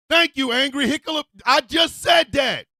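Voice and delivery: loud and high-pitched; angry retort. Acted. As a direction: snap back, fed up. Snapping back at the voice.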